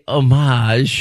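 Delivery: homage said oh-MAHZH, drawn out; mocked French homage. Host ridiculing co-host's word, homage as oh-MAHZH. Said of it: "it sounds ridiculous".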